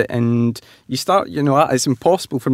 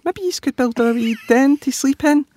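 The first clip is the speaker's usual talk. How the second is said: Daddy Pig voice. A narrator's reading.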